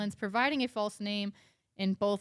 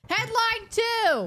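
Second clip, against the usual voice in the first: drawn-out high announcer call; slow, high announcer call; 6 dB louder, much slower than usual. Acted. Announcing the next headline.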